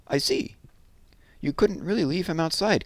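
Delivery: wife softening at the sleeping bear; soft Missus Brown. Acted. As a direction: melt a little, gently. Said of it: "said Missus Brown softly".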